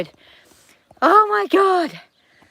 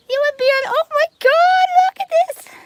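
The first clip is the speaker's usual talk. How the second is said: high, loud look-at-this; can barely contain it; thrilled squeal; excited gush; 7 semitones higher, 8 dB louder than usual. Real, not acted. Showing the babies to viewers.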